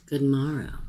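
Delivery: ordinary delivery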